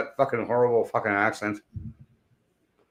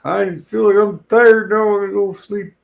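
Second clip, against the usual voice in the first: put-on higher, slow, drowsy old-timer voice; sleepy old man; 9 semitones higher, 6 dB louder than usual. Streamer imitating an old man heading for a nap, in a high, slow, drowsy voice. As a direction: play a worn-out old guy ready for bed. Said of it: "Sometimes when you get old".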